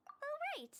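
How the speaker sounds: as if it just popped into mind; sudden afterthought to the client; sudden remembering. Acted.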